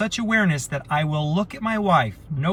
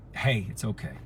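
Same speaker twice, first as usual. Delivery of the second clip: soft, lower "hey, it's okay"; gentle reassurance; 7 semitones lower, 8 dB quieter than usual. Shown as a demonstration. Voice message to someone upset, soft and tender. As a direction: ease them down softly, like a hand on the shoulder. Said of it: "so they can hear the tone in my voice".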